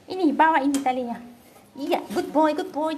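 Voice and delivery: ordinary delivery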